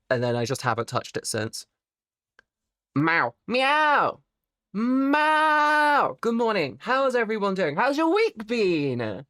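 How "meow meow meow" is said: playful meowing. Acted. Streamer meows at chat like a kitten. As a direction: meow like a cat begging, then stretch the last.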